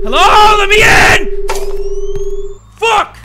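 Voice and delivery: very high, loud yelling; panicked shouting. Real, not acted. Player locked out by a door in the game, panicked shouting. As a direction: yell desperately to be let in.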